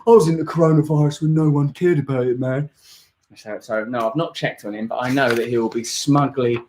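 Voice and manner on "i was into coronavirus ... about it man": hipster smugness. Retold or imitated. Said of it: "they go, "I don't really like it".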